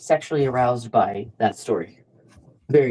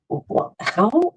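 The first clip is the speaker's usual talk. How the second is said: incredulous stammer; 9 semitones higher, much slower than usual. Retold or imitated. Retold exasperation at pushy man.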